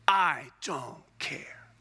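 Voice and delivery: slow whisper of a shrugging reader; whispered dismissal. Acted. Preacher to the church, shrugging off tabernacle details in a slow whisper. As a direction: breathe it out slowly, unbothered.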